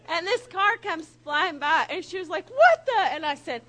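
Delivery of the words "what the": high, loud shock; imitated alarmed exclamation; startled outburst. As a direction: yelp it as a car flies by. Passenger startled by speeding car.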